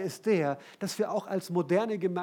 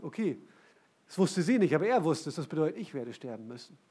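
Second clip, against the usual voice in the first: ordinary talk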